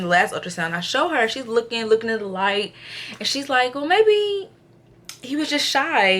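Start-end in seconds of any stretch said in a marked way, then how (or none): 3.7-6.2 high-pitched hedging; tentative excuse. Retold or imitated. Tech explaining away the missed boy parts, high and tentative. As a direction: imitate someone floating an excuse, pitched up.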